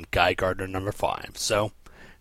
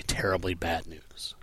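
whisper; 3 semitones lower, 8 dB quieter than usual. Acted. Dramatic whispered aside.

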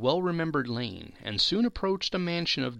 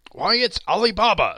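high, loud burst of delight; ecstatic exclamation; 8 semitones higher, 9 dB louder than usual. A narrator's reading. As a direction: cry out in pure joy at an old friend. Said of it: "Scrooge exclaimed in ecstasy".

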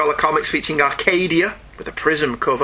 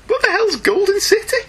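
much higher, baffled exclamation. Real, not acted. Host baffled by an odd comic title, high. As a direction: demand it in disbelief.

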